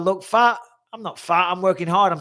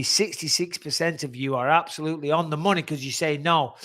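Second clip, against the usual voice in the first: ordinary talk, nothing marked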